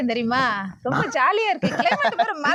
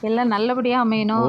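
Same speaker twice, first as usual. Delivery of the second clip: praying; 13 semitones lower than usual. Acted. Praying to god at temple.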